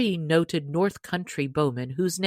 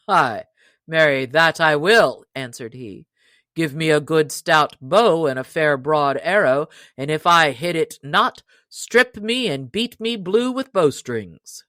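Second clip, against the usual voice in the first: loud, challenging yeoman voice; proud stranger; 6 dB louder than usual. Acted. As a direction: throw the boast back at him. Stranger accepts loudly.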